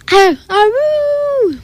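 high, long-held "aroo" howl; dog's howl. A narrator's reading. Marley the dog bellows before the egg hunt, high howl. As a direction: howl like an eager dog, drawn out. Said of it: "Marley bellowed".

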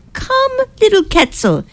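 high, excited call to a little kitten; excited cry. A narrator's reading. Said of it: "Moshe cried".

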